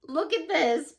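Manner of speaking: ordinary talk, nothing marked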